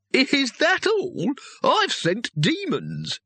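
put-on high, slow, scornful old wizard voice; boastful wizard. A narrator's reading. Wizard outdoing his rivals' failures, high scornful boast. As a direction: sneer as you trump the others.

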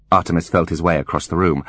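plain reading voice

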